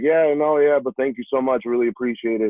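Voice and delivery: high, bright polite refusal; chirpy thanks but no thanks; polite decline. Retold or imitated. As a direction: player politely turning down a scholarship offer, bright and high. Politely declining the coach again.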